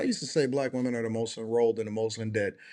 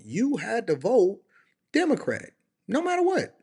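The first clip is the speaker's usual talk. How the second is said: mocking high voice; 8 semitones higher than usual. Mocking parents' voting rule.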